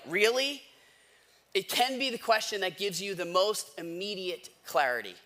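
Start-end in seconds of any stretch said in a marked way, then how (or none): 0.1-0.6 high, incredulous really; mock incredulous really. Pastor to congregation, a pointed doubting really. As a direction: ask it like you doubt yourself.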